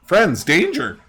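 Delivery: high, urgent broken-speech bird voice; high, panicky creature voice in short bursts. Acted. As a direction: squawk it out like a frantic bird-man. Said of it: "and he's like".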